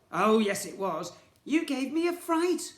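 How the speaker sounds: high, loud sneering shopkeeper; scornful villain twin. Acted. Twin shopkeeper blaming the boy, high loud sneer. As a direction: scorn the little vandal.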